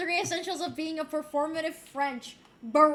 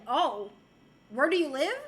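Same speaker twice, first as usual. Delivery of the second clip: normal talking voice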